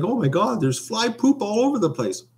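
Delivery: high-pitched cry of horrified shock; mock shocked. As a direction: retell it in a comically high, shocked voice. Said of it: "I was shocked".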